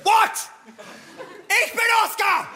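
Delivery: imitated child's high-pitched outburst; freaking out. Small son insists that he is Oscar, freaking out. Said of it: "ist mein Sohn ausgerastet".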